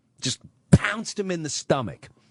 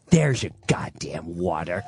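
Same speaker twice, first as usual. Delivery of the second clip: mother's spite; 9 semitones lower than usual. Retold or imitated. Mother flings water at father.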